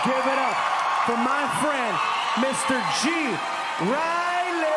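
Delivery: loud emcee introduction; loud stage announcer; host announcement. Acted. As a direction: hype up the guest. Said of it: "announcing guest".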